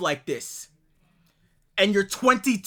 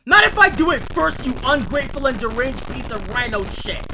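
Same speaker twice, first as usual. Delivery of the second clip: furious retort; 4 dB louder than usual. Real, not acted. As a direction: hit back at the threat with rage.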